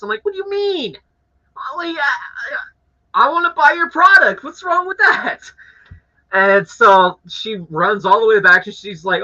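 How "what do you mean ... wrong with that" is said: high-pitched, sputtering indignant protest; enraged Karen impression. Customer arguing to be allowed to buy, exasperated. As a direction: play up the outraged customer, voice pitched way up. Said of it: "acting like an enraged Karen".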